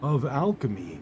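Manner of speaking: smooth narrator voice. Shown as a demonstration. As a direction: announce each item with gravitas. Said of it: "That one was actually okay without the eyebrow scrunch".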